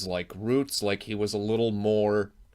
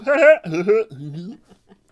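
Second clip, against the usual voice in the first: high laugh; 11 semitones higher, 11 dB louder than usual. Real, not acted. Laughs after his impression.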